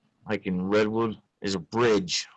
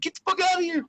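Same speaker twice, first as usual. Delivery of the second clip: mock shooing; faster than usual. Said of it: "I'd be like".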